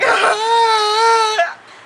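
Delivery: high, loud mock wail; crying wail. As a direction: wail as if bawling after a bump. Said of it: "I'm screaming".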